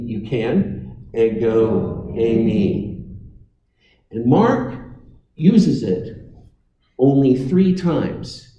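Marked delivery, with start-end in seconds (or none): 1.1-3.0 Ego eimi, each syllable slow and clear, e-go ei-mi; Greek said slowly to repeat. Shown as a demonstration. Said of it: "If you want to say that with me".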